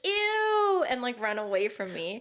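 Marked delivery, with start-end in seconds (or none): 0.1-0.9 high, loud disgusted squeal. Retold or imitated. Kids recoiling from her on the playground, squealing a disgusted ew. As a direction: squeal it like a grossed-out kid.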